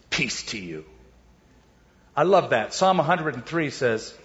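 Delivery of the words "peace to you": much softer and lower, gentle; tender peace. Retold or imitated. Jesus calming his scared disciples, soft and low. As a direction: soothe frightened friends softly.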